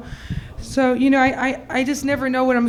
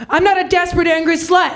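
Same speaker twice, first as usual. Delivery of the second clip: raised-pitch mock-furious declaration; indignant outburst; 5 semitones higher, faster than usual. Woman kicking in the wrong office door, indignant outburst. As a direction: burst in and protest, hammed-up.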